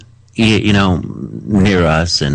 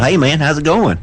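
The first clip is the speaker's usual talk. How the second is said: higher-pitched imitation of a Southern accent; 8 semitones higher than usual. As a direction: mimic a good old boy's Southern greeting. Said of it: "worse Southern accent than I do".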